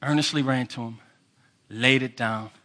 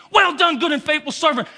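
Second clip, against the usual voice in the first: joyful shout; 8 semitones higher, 6 dB louder than usual. Shown as a demonstration. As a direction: shout "Well done" loud and high. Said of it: "He's gonna grab you".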